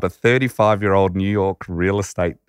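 ordinary talk, nothing marked

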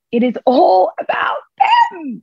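drawn-out, almost shouted repeat; emphatic outcry. Shown as a demonstration. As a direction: throw the line out with full emphasis. Repeated line, emphatic second take.

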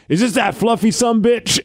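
lightly put-on wrestler phone greeting; Stone Cold Steve Austin. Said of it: "Stone Cold Steve Austin here".

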